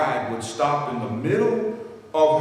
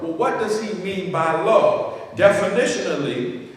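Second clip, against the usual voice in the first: ordinary talk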